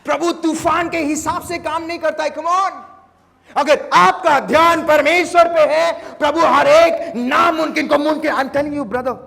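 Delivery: high, loud altar call; fiery preaching. Acted. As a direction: urge the congregation on at full force. Closing high-energy preaching.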